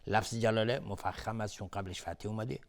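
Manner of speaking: ordinary talk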